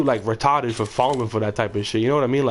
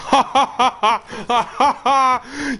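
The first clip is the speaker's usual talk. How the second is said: long, high-pitched run of ha-ha-ha; gloating laugh; 12 semitones higher, 3 dB louder than usual. Real, not acted. Gloats after muting the other player.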